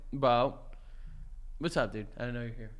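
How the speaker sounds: put-on low, quiet, slurred drunk husband. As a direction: play a tipsy husband coming home. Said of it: "get in fucking character here".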